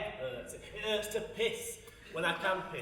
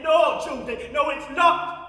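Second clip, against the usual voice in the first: louder, firm no-no; loud retort; 6 semitones higher, 13 dB louder, slower than usual. Acted. Lonely pensioner telling the young lot off, loud. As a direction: slam the point home. Retorts to her own question.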